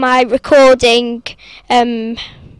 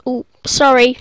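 normal talking voice

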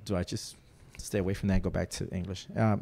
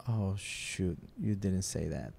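hushed self-scolding, lower; quiet scolding inner voice; 3 semitones lower, 9 dB quieter, slower than usual. Retold or imitated. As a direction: mutter it to yourself in a Cuban-free hush. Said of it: "the rational side of the brain goes".

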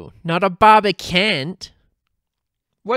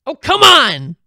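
louder, higher protest; frustrated outburst; 4 semitones higher, 7 dB louder than usual. A narrator's reading. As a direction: whine it at full volume, fed up. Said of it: "Louise slammed her fists down".

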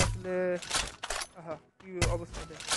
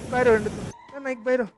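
excited outburst; 5 semitones higher, 9 dB louder than usual. Real, not acted. Hero knocked out in game.

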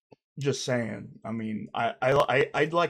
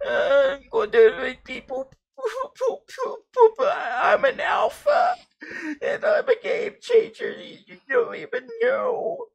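very high, louder wailing through mock sobs; hammed-up crying; 5 dB louder than usual. Sweetie threatening to sue everyone, mock-sobbing at the interviewer. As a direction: blubber out the threats, cracking up. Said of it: "Don't, don't cry".

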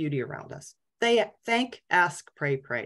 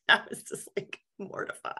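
breaking into a laugh, voice nearly gone; laughing embarrassment; 5 semitones higher, 4 dB quieter than usual. Real, not acted. Patient ending her embarrassing story, laughing. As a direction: squeeze the words out through laughter.

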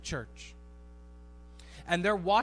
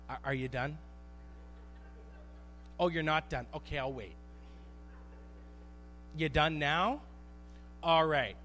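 patient parent voice; 3 semitones lower, 4 dB quieter than usual. Retold or imitated. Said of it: "we do this with our kids".

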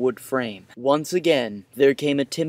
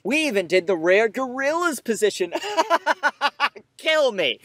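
laughing despair; 9 semitones higher than usual. Real, not acted. Self-disgusted laugh.

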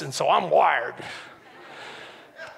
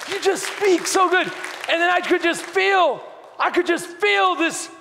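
fervent, raised preacher's voice; driving sermon delivery, pitched up; impassioned preaching; 12 semitones higher than usual. Real, not acted. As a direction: preach it with urgency and conviction. Preaches at full force.

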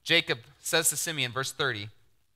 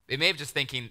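usual speaking voice

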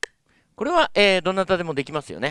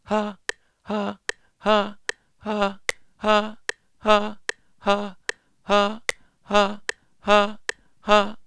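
airy, higher "ha" on the offbeat; steady breathy pulses on one pitch; weak, air-filled ha between clicks; 7 semitones higher, much slower than usual. Shown as a demonstration. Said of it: "ブレス的な声でやってみ".

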